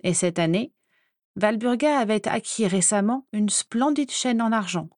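ordinary delivery

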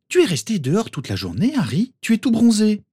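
surprised mother; Molly's voice. A narrator's reading.

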